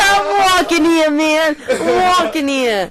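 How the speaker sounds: loud, high, hammed-up New York accent; exaggerated New York accent, slow and loud. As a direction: belt it out like a New York caricature. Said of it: "such a New York accent".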